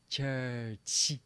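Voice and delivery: "Church" as two beats, chur-chee; big-smile pronunciation. Shown as a demonstration. Teacher repeats it for students, smiled "Church". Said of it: "Now give me a big smile".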